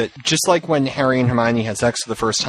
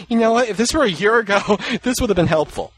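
laughing quote; 9 semitones higher than usual. Retold or imitated. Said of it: "he's like".

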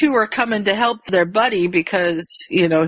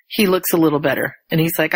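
ordinary talk, nothing marked

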